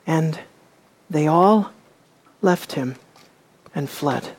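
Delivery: hushed, spaced-out words, fading away; solemn reading. Acted. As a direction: let each word drop into silence. Announcing the climactic verse.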